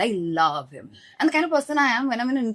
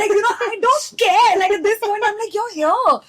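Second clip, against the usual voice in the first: overjoyed fan; 12 semitones higher, 7 dB louder than usual. Retold or imitated. Overjoyed with the idol.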